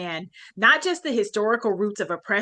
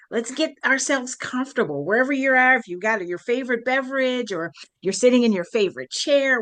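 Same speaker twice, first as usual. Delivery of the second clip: usual speaking voice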